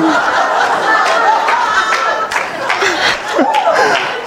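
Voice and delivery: long high burst of real laughter; big high laugh. Real, not acted. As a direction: crack up helplessly. Cracks up at his son.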